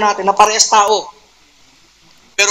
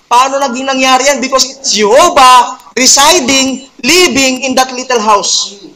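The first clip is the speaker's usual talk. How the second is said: raised, emphatic preacher's voice, lingering on "great creator"; fervent preaching; 5 semitones higher, 4 dB louder than usual. Acted. Preacher exulting to his congregation, loud and fervent. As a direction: build the wonder and call it out to the church.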